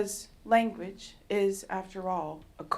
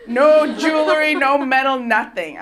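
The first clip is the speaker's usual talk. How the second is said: louder, laughing denial; loud laughing protest; 4 semitones higher, 13 dB louder than usual. Retold or imitated. Telling the tech she never touched metal, loud.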